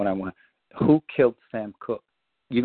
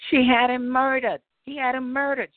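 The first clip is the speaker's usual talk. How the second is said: mock old lady voice, much higher; old woman's reveal; 14 semitones higher than usual. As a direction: blurt the secret in a high put-on voice. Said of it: "she said".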